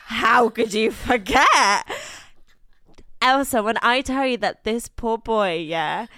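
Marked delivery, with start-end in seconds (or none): 0.0-1.9 slow indignant quote. Retold or imitated. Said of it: "I was like".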